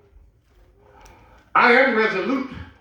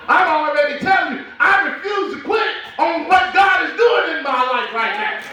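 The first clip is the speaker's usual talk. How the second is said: full-force preaching; 11 semitones higher than usual. Acted. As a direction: build it up to a shouting climax of conviction.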